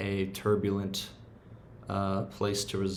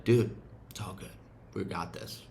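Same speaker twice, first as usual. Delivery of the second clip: reassuring parent. Retold or imitated. Parent reassuring inner child.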